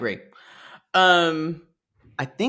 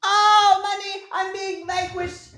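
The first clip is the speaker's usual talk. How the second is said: very high, wailing villain being dragged off; hammed-up squeals, stretching out the last cry; mock protest; 13 semitones higher than usual. Mother thrown out of the house, high melodramatic mock protest.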